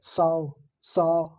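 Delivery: साव drawn out slowly, aa sliding to o; slow drawn saw. Shown as a demonstration. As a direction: stretch साव for the learner. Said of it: "इन्हें बोल के देखिए दोस्तों".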